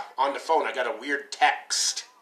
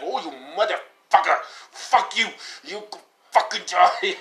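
high, outraged mock Indian accent; thick Indian accent; 6 semitones higher than usual. Scam caller cursing before hanging up, angry Indian accent. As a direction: curse them out in a put-on Indian accent. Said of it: "thick Indian accent".

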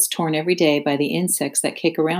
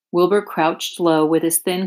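ordinary delivery

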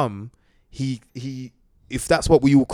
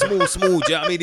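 laughing; 7 semitones higher, 4 dB louder, faster than usual. Real, not acted. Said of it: "I'm laughing at how Michael said it".

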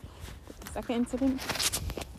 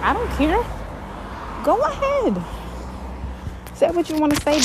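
high, loud, drawn-up "don't care" dare; defiant retort; 10 semitones higher, 5 dB louder than usual. Retold or imitated. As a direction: throw it back loudly, high-pitched and unbothered. Defiant retort about the boss.

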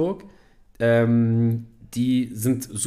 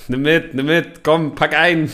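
louder, urging grab-everything voice; egging herself on at the shelves; pushy shopping urge; 3 semitones higher, 6 dB louder, slower than usual. Retold or imitated. (laut drängelnd, alles einpacken)

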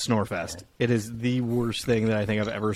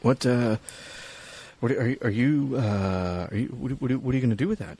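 halting false starts with long hesitations; stammering bank teller. Acted. Bank teller asking what you'll do with money, stammering. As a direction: stumble uneasily toward the question.

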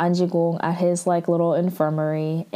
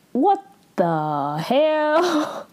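shocked what the hell; 8 semitones higher, much slower than usual. Retold or imitated. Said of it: "And she's like".

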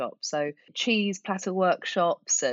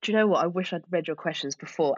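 ordinary delivery

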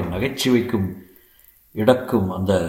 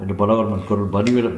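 ordinary delivery, nothing marked

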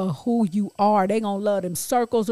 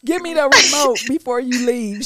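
lightly voiced fed-up ex; 3 semitones higher, 5 dB louder than usual.

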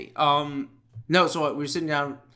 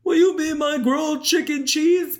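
high, hammed-up proposal voice; mock proposal; 5 dB louder than usual. Pretending to propose with menu items, high hammed-up voice. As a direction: propose to a scone, overdone.

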